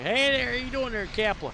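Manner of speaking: mock southern Klingon hello; southern Klingon greeting. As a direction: chirp it like a friendly southerner. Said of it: "Be like".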